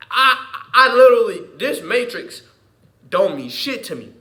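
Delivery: high, loud emphatic burst; loud outburst. Real, not acted. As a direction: blurt it out loud.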